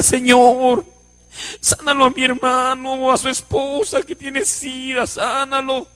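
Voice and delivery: drawn-out, hushed pleading prayer; imitated tearful plea. Brother prays at the altar for the sick man, tearful. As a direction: plead softly as if weeping. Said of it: "Y el hermano lloraba y decía".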